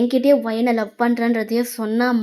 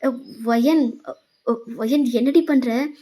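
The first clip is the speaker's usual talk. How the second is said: slow, stunned questions climbing higher; shocked; 3 semitones higher, slower than usual. Acted. Boyfriend startled by her sudden hug, shocked. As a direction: caught off guard, voice lifting in disbelief. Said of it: "அவன் shocked ஆயிட்டான்".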